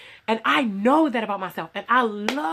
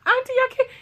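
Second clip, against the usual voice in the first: a high, louder panicked plea; pleading; 4 dB louder than usual. Retold or imitated. Begging off her husband's aunt's dress order, high panicked plea. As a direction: beg off in a high squeak of panic. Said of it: "And I'm like".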